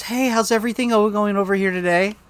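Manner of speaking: put-on restaurant manager greeting, starting high; fake customer voice. Shown as a demonstration. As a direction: pitch up the hello, then ease down. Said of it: "when I do table touches".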